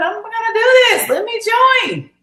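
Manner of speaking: squeaky high and fast; a hammed-up burst of eager resolve; mock excited. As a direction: shoot way up in pitch like a giddy kid. Retelling her own decision.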